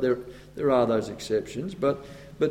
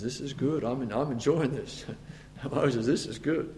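much softer, pleased and chuckling; amused God; 3 semitones lower, 10 dB quieter than usual. Retold or imitated. God quietly enjoying Moses' humility. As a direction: savour it quietly like a satisfied father. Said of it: "God says".

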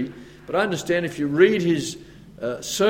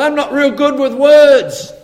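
higher, louder and slow, a shy self-doubting voice; timid Moses; 10 semitones higher, 10 dB louder, slower than usual. Retold or imitated. Moses excusing himself to God, slow and timid. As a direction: stretch out the excuse like a nervous man. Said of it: "I am slow of speech and of a slow tongue".

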